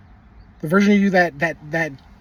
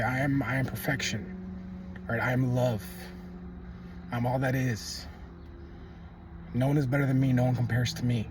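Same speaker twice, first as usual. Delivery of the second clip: calm affirmation; 6 semitones lower, 9 dB quieter than usual. Shown as a demonstration. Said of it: "you say".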